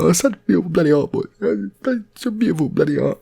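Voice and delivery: loud, high, fast gushing; awed bloke. Retold or imitated. Barry gushing at the paintings, loud and high. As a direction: gush like a moved bloke.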